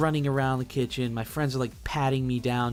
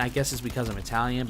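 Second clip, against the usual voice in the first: plain reading voice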